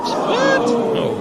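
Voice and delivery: surprised. Real, not acted. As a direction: react in pure disbelief. Reacting to listener letter.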